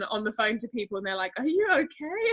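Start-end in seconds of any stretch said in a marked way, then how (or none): 1.4-2.3 high-pitched imitation of worried callers; mock concern. Imitating callers hearing her cry, mock concern. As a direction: lightly mimic someone checking on her. Voicing concerned people on phone.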